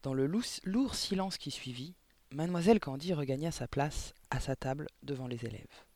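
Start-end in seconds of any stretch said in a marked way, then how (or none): none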